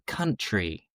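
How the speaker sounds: "country" with an open southern AH vowel. Shown as a demonstration. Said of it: "they'd always make us say".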